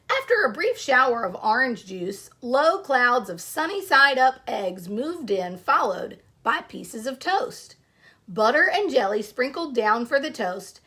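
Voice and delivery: storybook read-aloud to a class; story narration. Acted. Teacher reading a picture book to her class, storytelling voice.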